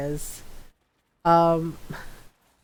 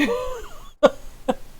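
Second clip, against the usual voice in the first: high laughing cry. Real, not acted. Laughing hard.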